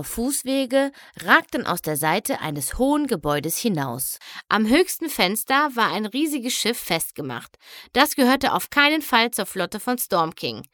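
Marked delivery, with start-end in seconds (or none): none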